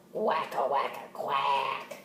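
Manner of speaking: slow low sleepy quacking; duck snoring. Acted. Teacher voicing Duck snoring, slow and low. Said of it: "snored Duck".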